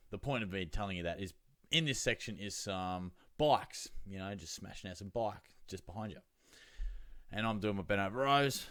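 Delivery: ordinary talk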